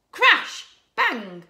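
loud, high exclamations of crash and bang; sudden crash. A narrator's reading. Reader springing the freezer escape on children, sudden crash. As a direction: burst out with each noise word.

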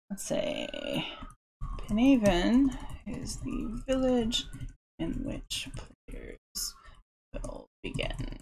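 a soft, breathy whisper to herself; whispered dictation. Real, not acted. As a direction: whisper to yourself while writing. Muttering what she writes down.

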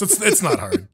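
ordinary talk, nothing marked

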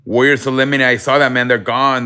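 loud, eager news blurt; excited outburst. Real, not acted. Streamer tells chat the Warriors are out, excited. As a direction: burst out with the result.

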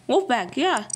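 ordinary talk

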